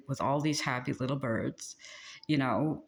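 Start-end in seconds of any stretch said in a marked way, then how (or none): none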